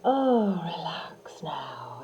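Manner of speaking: breathy whispered oh relax now, slowing down; soft relaxing sigh. Shown as a demonstration. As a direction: sigh it out like letting go. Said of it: "So do a little demo of that".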